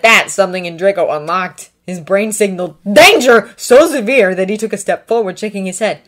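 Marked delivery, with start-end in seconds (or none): none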